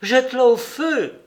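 high, loud order; an emphatic, impulsive command; lively exclamation. A narrator's reading. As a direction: cry it out high and forcefully. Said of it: "s'écria vivement".

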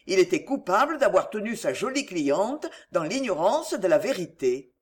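plain reading voice

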